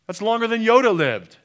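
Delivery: high, loud preacher's cry; soaring pulpit proclamation; full-force preaching. Acted. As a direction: drive the sum home at full force. Preaching climax on the debt.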